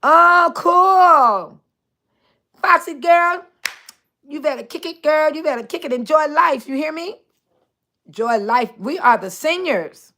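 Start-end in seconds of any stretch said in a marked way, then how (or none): none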